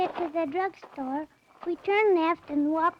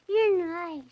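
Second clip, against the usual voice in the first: usual speaking voice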